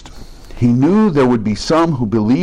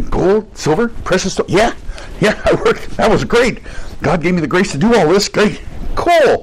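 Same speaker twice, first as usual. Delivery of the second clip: a breezy, higher-pitched send-up of a smug churchgoer; mock self-satisfied believer; 6 semitones higher than usual. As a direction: toss it off lightly, as if heaven were a casual errand. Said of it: "I do not picture someone going".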